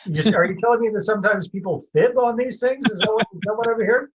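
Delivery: high-pitched teasing incredulity; playfully scandalized question; mock disbelief. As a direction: feign innocent surprise, voice lifted high. Playfully feigning shock at fibbing.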